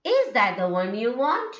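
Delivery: demonstrated rising question, top note on "want". Shown as a demonstration. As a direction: lift the pitch right up through "want". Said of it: "Okay, raising, raising".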